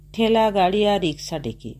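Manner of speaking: ordinary delivery, nothing marked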